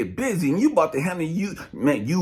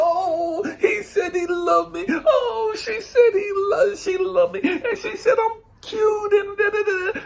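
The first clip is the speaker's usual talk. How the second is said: gushing lovestruck listener; 12 semitones higher than usual. Acted. Mocking people who believe they are loved, hammed-up gushing. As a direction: squeal it like a hammed-up, flattered sweetheart. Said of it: "you just go".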